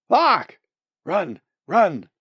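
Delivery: loud, high cries of alarm; squawk, then urge yourself on loudly; frantic squawk. Acted. Bird stung in the tail fleeing, frantic.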